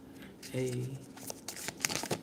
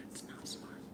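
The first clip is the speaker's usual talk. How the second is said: hushed whisper to herself, holding it back; 24 dB quieter than usual. Real, not acted. Whispering to herself so she won't say it. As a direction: whisper it like you're stopping yourself.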